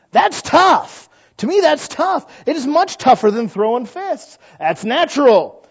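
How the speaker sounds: high and loud, pressing the point; emphatic preaching. Real, not acted. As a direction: drive it home to the congregation.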